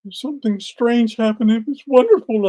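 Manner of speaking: high, awed wife voice; wife's voice. Retold or imitated. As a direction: voice her high and wondering. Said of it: "She said".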